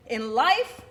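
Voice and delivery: angrier mother. Acted. Mother lecturing her child about life, angry. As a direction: lecture your child, voice climbing. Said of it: "She got even angrier".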